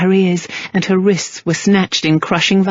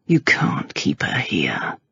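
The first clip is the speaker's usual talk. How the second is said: low, slow near-whisper; soft; 11 semitones lower, 5 dB quieter, slower than usual. A narrator's reading. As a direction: say it softly and low. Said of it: "Gentry said softly".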